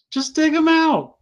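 loud, high, drawn-out exasperated plea; exasperated retort. Acted. Fan scolding the cartoon Autobots for leaving him, exasperated.

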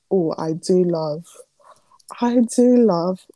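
ordinary delivery

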